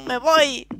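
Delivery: laughing cry. Pretend. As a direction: wail while laughing, half crying. Laugh-cry after being refused.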